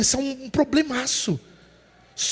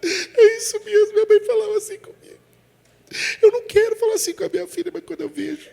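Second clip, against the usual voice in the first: high-pitched, tearful mother's confession; hammed-up high weepy voice; imitated crying; 9 semitones higher than usual. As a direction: imitate a woman wailing through her words. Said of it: "essa mulher começou a chorar".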